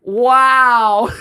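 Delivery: high loud laughing wow; a big laughing cheer after the lip sync. Retold or imitated. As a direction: gush it with a shrieking laugh. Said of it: "She'd go".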